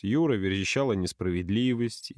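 plain reading voice

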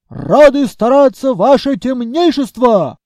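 loud, high soldier's yell; shouting in chorus. Acted. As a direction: bellow it like a drilled squad. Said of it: "хором заорали скелеты".

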